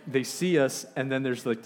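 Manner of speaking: normal talking voice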